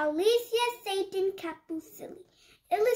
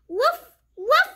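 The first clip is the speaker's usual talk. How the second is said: high happy woofs, laughing; puppy bark with a laugh; 7 semitones higher, 8 dB louder than usual. Acted. Biscuit barking at the fort builders, laughing high woofs. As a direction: bark playfully and giggle.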